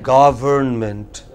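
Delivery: "Government" drawn out very slowly; slow teacher model of "Government"; slow syllable drill. Shown as a demonstration. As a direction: stretch out each syllable of "Government". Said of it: "break it into syllables".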